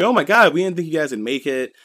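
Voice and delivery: a burst of high, loud relief on the opening cry; mock cheer of relief that quickly settles; excited relief. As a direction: comrades thrilled to see them back alive. Comrades greet the survivors excitedly.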